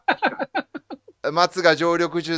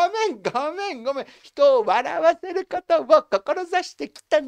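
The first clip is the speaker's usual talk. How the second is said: mock pleading; 11 semitones higher than usual. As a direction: whine it like a man caught out. Imitating him pleading.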